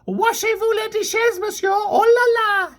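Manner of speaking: high, whiny mock French accent; French accent whine. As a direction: scold in a shrill French whine. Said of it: "scolds in a high-pitched French accented, French accented whine".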